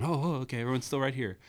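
high, relieved cartoon kid; sudden relief at seeing everyone. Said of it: "they can see everyone".

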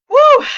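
high, loud joyful woo; excited whoop. Real, not acted. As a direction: cheer the audience in with a big whoop. Cheering greeting to live audience.

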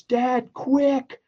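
retold yell. Daughter jumping out of the crashed car yells to dad, high urgent cry. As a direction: squeal it out in the daughter's panicked voice. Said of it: "and yells".

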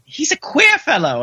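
squeaky high prim whisper-gossip; tattling in a high voice; high gossipy maid. Retold or imitated. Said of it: "she's like".

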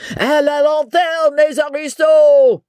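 high, drawn-out French shout; howling mob. A narrator's reading. Mob cries for aristocrats' blood, high howling shout. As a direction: howl it slowly like a baying crowd. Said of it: "while the mob howled".

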